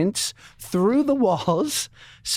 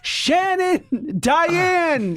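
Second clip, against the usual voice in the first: mock passionate cry; 10 semitones higher, much slower than usual. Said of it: "scream out "Shannon".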